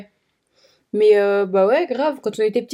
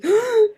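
petit hoquet de panique imité; 11 semitones higher than usual. Retold or imitated. Retold panic gasp.

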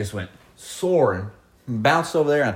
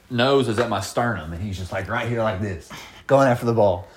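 usual speaking voice

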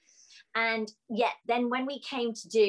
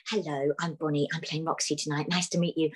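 polite British introduction, pitched lower; very British accent; 4 semitones lower than usual. Retold or imitated. Actress greeting her co-star backstage, very British accent. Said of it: "in this very British accent".

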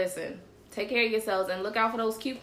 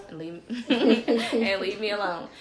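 laughing; 4 dB louder, slower than usual. Real, not acted. Laughs while joking about husband.

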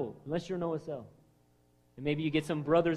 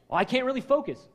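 whiny excuse; 8 semitones higher, 6 dB louder than usual. Retold or imitated. Mocking excuse-maker.

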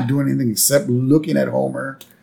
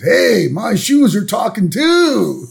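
higher, drawn-out stoner wonder; spaced-out amazement; 9 semitones higher, 4 dB louder, slower than usual. Retold or imitated. Stoned concertgoer hearing his shoes talk, amazed. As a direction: marvel slowly, voice lifting.

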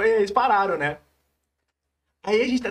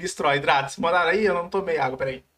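ordinary talk

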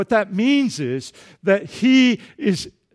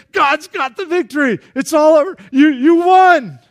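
triumphant declaration; 7 semitones higher, 7 dB louder than usual. Retold or imitated. Prophet telling the frightened king he won, rising joy. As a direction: announce the win with rising joy.

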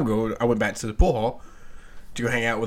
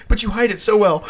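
laughing; 8 semitones higher than usual. Retold or imitated. Quote laughed out.

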